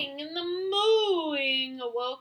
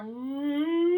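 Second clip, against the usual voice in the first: higher, louder mooing moan; loud cow moan; 5 semitones higher, 5 dB louder than usual. Acted. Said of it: "moaned louder".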